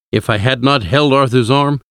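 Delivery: ordinary delivery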